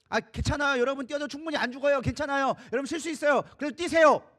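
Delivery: loud, high reassurance building to a shout; hearty urging. Acted. Urging the scared listeners to jump into dark water, loud encouragement.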